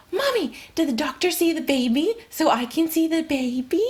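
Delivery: put-on eager kid's rush of questions; excited child. Son bursts out to mom coming home, excited. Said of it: "And he's so excited".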